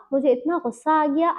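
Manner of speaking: usual speaking voice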